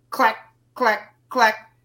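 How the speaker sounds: sharp clicking clack sounds; mimicked neck-cracking clacks; imitated clacking. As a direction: click it out like bones snapping. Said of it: "when I heard the clack".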